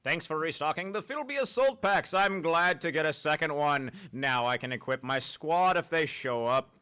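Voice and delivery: odd character read. Acted. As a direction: read it like a chirpy shopkeeper. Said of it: "I don't know why I read it like that".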